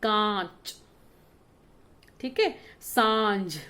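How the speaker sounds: कांच and सांझ slow, vowel through nose and mouth; drawn out कांच सांझ with a nasal vowel; nasal vowel demo. Shown as a demonstration. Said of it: "नाक और मुंह दोनों से हमें आवाज सुनाई पड़ती है". (कांच, सांझ धीरे, नाक और मुँह दोनों से)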